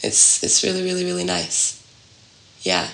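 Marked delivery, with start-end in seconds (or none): none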